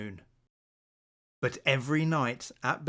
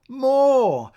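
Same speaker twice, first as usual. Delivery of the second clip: high, loud excited cry; eager shout; 11 semitones higher, 12 dB louder than usual. Acted. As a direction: shout it out, begging for more. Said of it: "cried everyone".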